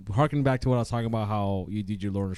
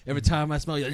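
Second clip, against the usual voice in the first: half-laughing mimic of the song's gurgle; mock gurgly vocal; 4 semitones higher than usual. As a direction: lightly imitate the nasty growl on "Every time I smell you", then laugh. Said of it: "'cause you go".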